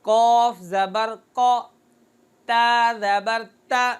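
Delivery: slow, careful open-mouth recitation; correct zabar. Shown as a demonstration. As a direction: open the jaw on each zabar syllable. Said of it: "मुंह खोल कर इसको पढ़ेंगे तो ये सही".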